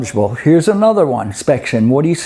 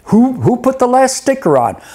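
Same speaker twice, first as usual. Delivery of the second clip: mock investigator; 7 semitones higher than usual. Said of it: "it's like".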